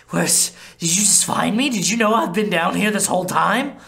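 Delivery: hurt vampire demanding answers; indignant Laszlo. Acted. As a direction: make her feel guilty.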